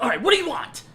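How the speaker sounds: high, loud snippy mock of an annoyed man; imitating him upset. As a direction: ham up his irritation. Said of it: "He gets so upset".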